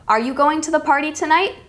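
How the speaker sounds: yes-no question with a clear rise on "tonight"; rising intonation. Shown as a demonstration. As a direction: let "tonight" lift right up at the end. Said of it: "It's rising".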